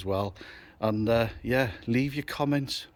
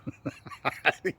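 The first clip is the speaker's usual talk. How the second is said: breathy giggle; 5 semitones higher, 5 dB quieter than usual. Real, not acted. Laughing at sulking Brad.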